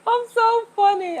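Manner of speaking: giggly self-amused squeal; laughing at herself. Real, not acted. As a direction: crack yourself up. Cracking up at herself.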